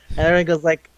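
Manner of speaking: ordinary talk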